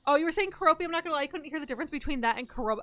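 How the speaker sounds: ordinary talk, nothing marked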